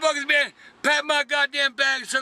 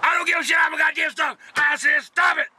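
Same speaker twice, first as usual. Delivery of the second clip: angry yelling; 5 semitones higher than usual. Real, not acted. Driver yells at his filming passenger in traffic, furious. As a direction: snap at him to knock it off.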